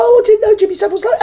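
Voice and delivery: high, fast feigned surprise; squeaky mock-naive quote; mock innocent gasp. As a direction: play the shocked innocent, quick and high. Mocking the public's feigned surprise.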